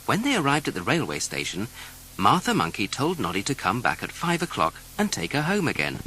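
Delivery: usual speaking voice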